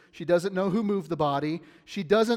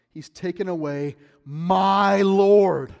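louder, slow, emphatic "He's taken away my Lord."; emphasis on taken; 3 semitones higher, 10 dB louder, slower than usual. Shown as a demonstration. Preacher stresses Mary's words to the congregation. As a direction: lean hard on "my Lord", slow. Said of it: "My emphasis added there".

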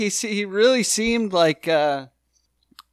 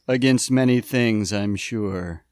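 a low, dry quip, dropping away at the end; lightly voiced disdainful judge; 10 semitones lower, 5 dB quieter than usual. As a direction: sneer it under your breath, very dry. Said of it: "And he goes".

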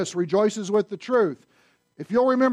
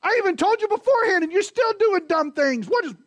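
high exasperated telling-off; preacher acting a fed-up parent at Peter; mock parental scolding; 12 semitones higher, 4 dB louder than usual. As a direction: nag like a frustrated parent. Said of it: "Sounds like parenting".

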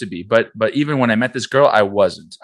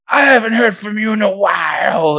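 high, loud, whiny imitation of her upset call; mock crying complaint; 12 semitones higher, 5 dB louder than usual. Girl crying on the phone to him, high whiny wail. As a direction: wail it like she did, half mocking. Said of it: "she was crying".

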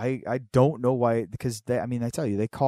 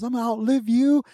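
high, loud taunt with a laugh breaking in; imitated yelling; 13 semitones higher, 10 dB louder, faster than usual. Dad taunting the neighbour, high shouted boast. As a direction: crow it like you'll never die. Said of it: "he's yelling this shit".

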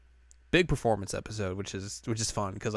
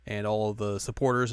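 ordinary talk

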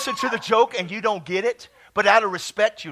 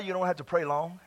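normal talking voice

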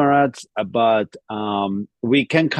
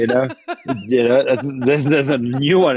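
laughing; 4 semitones higher than usual. Real, not acted. Laughing at the food tangent.

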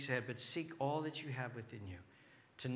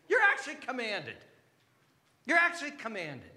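loud, high proclamation; emphatic preaching; 10 semitones higher, 10 dB louder than usual. Real, not acted. Preacher telling listeners they are commanded, loud and emphatic. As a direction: proclaim it forcefully, pitch jumping up.

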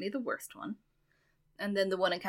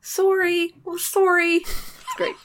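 put-on high, loud Canadian accent; chirpy Canadian accent, apologetic; 11 semitones higher, 9 dB louder, much slower than usual. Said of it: "Can you give us a taste?".

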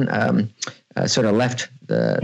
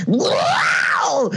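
cartoon kid voice. Cartoon lead's catchphrase.

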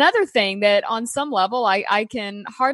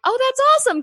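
excited; 13 semitones higher, much faster than usual. Retold or imitated. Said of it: "I was like".

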